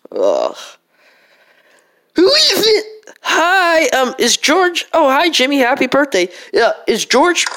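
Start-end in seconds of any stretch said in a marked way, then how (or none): 2.2-5.9 put-on high squeaky kid call; high character voice.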